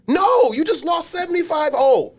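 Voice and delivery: high, slow, shouted no; incredulous shout. Retold or imitated. Parent to kid after a blowout loss, incredulous shout. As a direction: shout it in disbelief.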